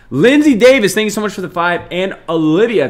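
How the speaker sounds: high, loud thank-you to donors; excited shout-out. Real, not acted. Streamer calls out gift givers.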